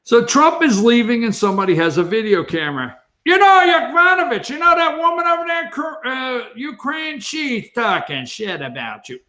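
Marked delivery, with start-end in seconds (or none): 3.2-9.2 high, excited tattletale voice, dropping off at the end; hamming up a donor snitching to the president; mock tattling donor. Donor whispering to Trump.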